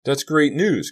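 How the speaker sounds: high, loud burst of delight; happy cry. A narrator's reading. Kerry hearing Sal is fine, happy cry. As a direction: cry out with relief and joy. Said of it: "Kerry cried happily".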